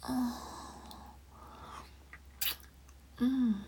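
slow, drawn-out ASMR whisper. Acted. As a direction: linger on every word in a whisper.

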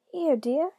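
high, loud cooing granny; put-on doting grandmother. A narrator's reading. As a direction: call softheartedly in a high granny lilt. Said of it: "in his best grandmother voice".

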